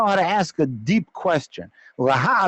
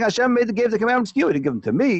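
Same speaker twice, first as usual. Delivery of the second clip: mocking quote; 7 semitones higher, faster than usual. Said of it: "He's saying".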